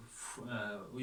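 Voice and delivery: ordinary talk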